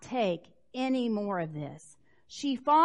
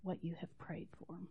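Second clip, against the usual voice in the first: gentle blessing; 5 semitones lower, 12 dB quieter than usual. Acted. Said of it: "And he says".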